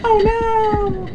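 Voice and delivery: very high, loud, squeaky cartoon panic; squeaky panicked voice. Streamer squeals at a bear in the game, very high and panicked. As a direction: squeal it out in a tiny frantic voice.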